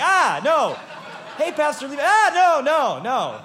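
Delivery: high, loud, laughing protest; laughing alarmed no at the urinal; panicked refusal. Retold or imitated. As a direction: fend him off, loud and high. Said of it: "I'm like".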